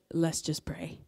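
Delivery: soft, low and gentle; calm friend imitation. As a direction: drop low and soft, quietly steadying someone. Friend calmly offers prayer on phone.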